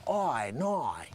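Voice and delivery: soft, drawn-out mock Australian "Oi, noi"; overdone Australian accent. Shown as a demonstration. Comedian shows listeners what not to do, laboured Australian accent. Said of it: "it's not like you have to say".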